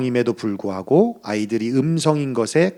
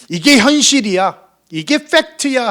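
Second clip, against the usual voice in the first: higher-pitched, mildly acted insistence; insistent objection; 9 semitones higher than usual. Preacher mimicking those who call gratitude unrealistic, insistent. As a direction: voice an objector pressing the point.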